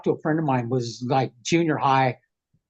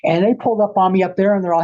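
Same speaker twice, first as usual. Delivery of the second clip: usual speaking voice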